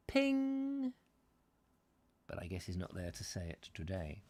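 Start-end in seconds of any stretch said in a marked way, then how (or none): 0.1-0.9 high little ping sound; ding it like a tiny bell; mock ping. Imitating Nigel Sadler's star sound, high ping. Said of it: "as Nigel Sadler would say".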